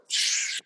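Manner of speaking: long unvoiced hushing noise; imitated trickle. Dad showing his son taking a pee, imitated stream hiss. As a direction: shush like running water.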